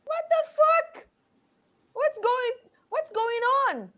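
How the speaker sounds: very high, panicked squeal; panicked shock. Acted. Player reacts to a half body twitching, high panicked shock.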